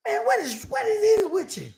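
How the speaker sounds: very high, strained disbelief; squeaky-high mock exasperation; exasperated lawyer. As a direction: imitate a lawyer at his wits' end.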